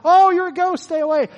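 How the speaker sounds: high, loud mock panic; mock fright. Pastor mimicking Peter seeing a ghost, hammed-up fright. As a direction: shriek it like a scared man.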